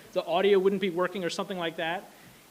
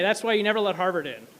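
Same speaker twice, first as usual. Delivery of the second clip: ordinary delivery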